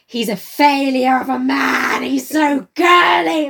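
loud, slow sneering complaint; hammed-up scornful rant; mock disdain. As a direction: sneer it like a snob. Mocking Mary's disdain, loud slow.

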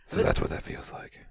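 all-breath Batman imitation; mock-menacing breathy line. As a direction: rasp it without voice like Batman. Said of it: "doing the Batman voice".

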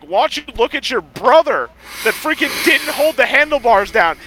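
put-on high, indignant kid's yell at the brother; a kid complaining to mom about the uncle, angry yell; retold angry yell. Kid yelling to mom after crash.

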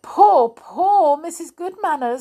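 high, lamenting storybook voice; mournful. A narrator's reading. Clockwork Mouse pities the burst Missus Goodmanners, mournful. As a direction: lament with exaggerated pity.

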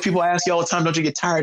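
high, eager retelling of the friend; lightly mock-excited high voice; hyped-up buddy. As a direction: pump it up like he's buzzing. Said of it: "He goes".

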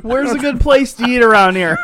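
high, loud, laughing bear. Pretend. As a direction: ham up a hungry bear. Bear asks rider.

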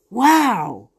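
usual speaking voice

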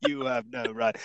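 solemn objection. Retold or imitated.

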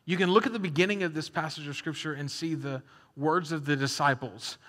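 ordinary talk

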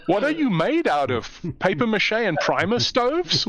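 high, swooping incredulous outburst; mock incredulous. Gamer to an exploding flare gun, incredulous. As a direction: ask it in exaggerated, high-pitched disbelief. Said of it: "I'm going, if I was Alan Wake".

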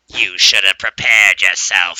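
slow, loud, menacing voice; spooky warning. Retold or imitated. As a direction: loom over him with a slow threat. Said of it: "And I hear".

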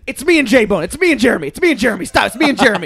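high, loud frantic pleading; panicked pleading. Retold or imitated. Friend imitating himself begging Mitch not to call police.

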